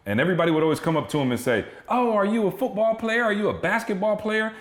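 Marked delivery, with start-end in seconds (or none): 1.9-4.5 much higher mock fawning voice; high-pitched imitation of people quizzing a little kid; imitating eager strangers. Voicing gushing strangers greeting his son.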